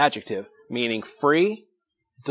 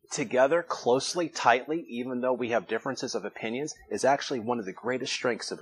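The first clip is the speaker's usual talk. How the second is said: usual speaking voice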